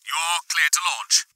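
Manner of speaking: formal clearance over the comm; stiff and formal. A narrator's reading. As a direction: grant it stiffly through a radio. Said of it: "his voice stiff and formal".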